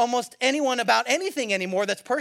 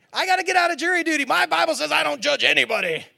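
mock excuse; 4 semitones higher than usual. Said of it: "Some of you have been like".

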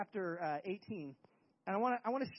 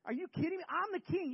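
high-pitched, incredulous protest; acted indignant outburst; affronted king; 7 semitones higher than usual. Saul's acted outrage at the song.

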